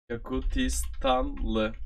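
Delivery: each syllable drawn out slowly, Yakutistanlı; slow demo. Shown as a demonstration. Teacher models the word for the learner, slow and careful. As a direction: spell out Yakutistanlı slowly so the learner catches every sound. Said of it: "Yak-, oy".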